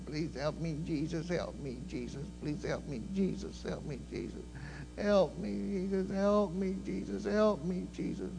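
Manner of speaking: quiet, pleading prayer under the breath; soft pleading. Retold or imitated. Girl at the altar quietly begging Jesus for help. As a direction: beg Jesus softly, over and over. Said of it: "she was begging for help".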